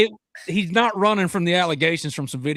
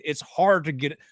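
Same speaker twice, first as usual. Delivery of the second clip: ordinary talk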